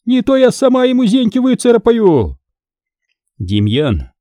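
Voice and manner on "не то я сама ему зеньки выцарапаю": much higher furious screech; wife screeching at the monk; furious shriek. Acted. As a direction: shriek a threat in fury. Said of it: "яростно взвизгнула".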